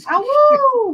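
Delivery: high yelping wolf howl; howling like a wolf pup. Pretend. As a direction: throw your head back and howl ah woo. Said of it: "Where's the ah, woo verse?".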